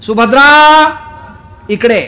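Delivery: loud, high summons; calling out. Acted. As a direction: call out to her sharply across the palace. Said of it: "पहिला आवाज दिला".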